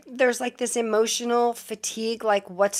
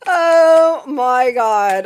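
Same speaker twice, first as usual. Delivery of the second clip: stunned; 12 dB louder, much slower than usual. Real, not acted. Stunned after stream glitch.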